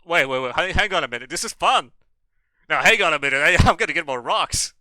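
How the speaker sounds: loud, high excited outburst; loud gamer's thrilled exclamation; excited shouting. Real, not acted. Sudden excitement while playing game.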